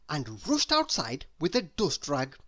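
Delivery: ordinary delivery, nothing marked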